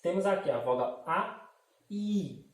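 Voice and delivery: ordinary delivery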